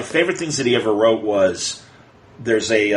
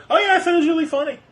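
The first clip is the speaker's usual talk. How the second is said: mocking fan reviewer; much faster than usual. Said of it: "But they're".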